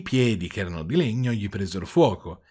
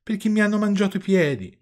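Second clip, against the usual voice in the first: much higher puppet voice, lamenting; tearful complaint; 10 semitones higher, 4 dB louder than usual. A narrator's reading. Weeping puppet explains his missing feet to father, tearful complaint. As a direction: wail it in mock misery.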